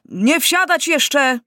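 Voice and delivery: slow, high-pitched shouted order; calling from afar. A narrator's reading. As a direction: call to troops across a platform. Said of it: "zawołał, biegnąc z dala".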